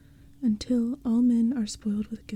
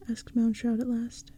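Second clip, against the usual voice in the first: ordinary delivery, nothing marked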